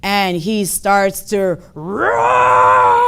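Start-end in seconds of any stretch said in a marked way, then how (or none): 1.7-3.1 long, loud lion roar; loud bellowing roar. Acted. Older lion showing the cub how, bellowing roar. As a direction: bellow a huge, drawn-out roar. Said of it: "This loud, bellowous lion roar".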